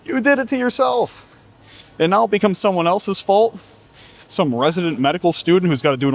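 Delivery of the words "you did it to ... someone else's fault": high, mocking scold; mock them for blaming others; incredulous high-pitched taunt; mocking disbelief. Real, not acted.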